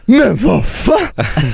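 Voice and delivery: drawn-out high what the hell; baffled outburst. Retold or imitated. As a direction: stretch out the confusion. Albin baffled by waiter. (förbluffad utdragen fråga)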